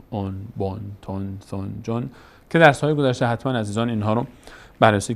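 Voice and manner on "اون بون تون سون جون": اون، بون، تون، سون، جون each with rounded on ending; tanween on sounds. Shown as a demonstration. Teacher demonstrating double damma as on, sound by sound.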